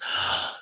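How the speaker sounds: a quick surprised gasp in; sharp gasp. Real, not acted. Host spots friend in chat, gasps in surprise. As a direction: gasp as someone shows up.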